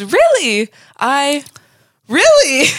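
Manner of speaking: stunned and climbing in pitch; shocked disbelief. Retold or imitated. Frasier reacting to Daphne's story, shocked and climbing.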